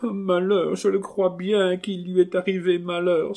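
high, tearful wail; acted sobbing. Marquise telling of the misfortune, high acted sobbing. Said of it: "s'écria la marquise en sanglotant".

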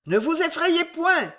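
high-pitched cry to the daughters; calling out. A narrator's reading. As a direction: call out a reassurance, pitched well up. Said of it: "cria monsieur Wardle".

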